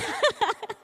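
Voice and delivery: high burst of laughter. Real, not acted. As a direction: laugh at your own confession. Jokes about running off to Taiwan. (tawa lepas bernada tinggi)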